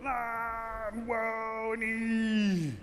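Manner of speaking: long, high drawn-out wail; high-pitched "whoa" and squeal; tossed turtle's cry. Acted. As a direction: cry out as if flung through the air. Tossed turtle cries out in flight.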